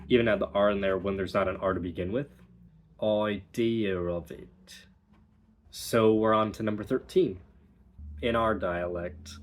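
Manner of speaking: ordinary talk, nothing marked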